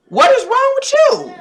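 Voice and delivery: high, slow disbelief; exasperated. Real, not acted. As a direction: ask it high and drawn out, baffled. Exasperated at viewers' choices.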